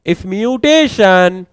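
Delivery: each word drawn out slowly for writing down; slow dictation. Shown as a demonstration. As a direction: spell it out slowly for the notes. Dictating notes to students. (धीरे-धीरे लिखवाना)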